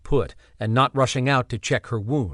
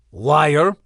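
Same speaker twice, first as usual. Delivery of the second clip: put-on louder accusing cry in a Slavic accent; 2 semitones higher, 5 dB louder than usual. Ixter accusing Bernie, sharp Slavic accent. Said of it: "Ixter charged in his Slavic accent".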